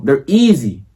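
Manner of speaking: ordinary delivery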